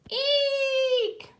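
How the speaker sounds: high shrill squeal of surprise; tiny startled eek. Acted. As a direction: squeak it out like a startled little spider. Spider shrieks at the fountain.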